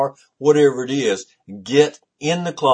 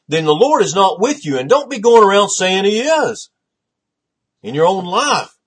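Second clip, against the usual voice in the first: loud, higher fiery sermon rebuke; preaching at full force; 4 semitones higher, 5 dB louder than usual. Acted. Pastor rebuking churches that shut out the Lord, loud fiery preaching. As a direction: drive it home like a revival preacher.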